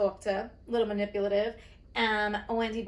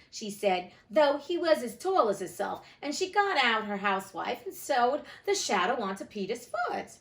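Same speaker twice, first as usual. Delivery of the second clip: ordinary delivery, nothing marked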